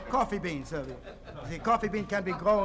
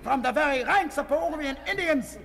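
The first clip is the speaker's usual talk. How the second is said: ranting officer; 9 semitones higher than usual. Pretend. Fake Indian shouts his army career to the interviewer. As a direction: bark out your ranks proudly. Raving about his rank.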